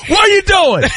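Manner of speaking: high, mock-stern shout through a laugh; watchful dad calling out. Man woken at night scolding the household, high mock-stern call.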